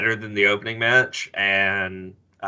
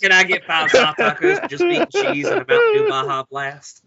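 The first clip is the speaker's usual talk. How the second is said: put-on southern accent; 9 semitones higher, 4 dB louder than usual. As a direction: order tacos in a thick southern accent. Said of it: "southern accent when he orders Taco Bell".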